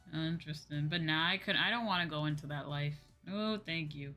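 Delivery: usual speaking voice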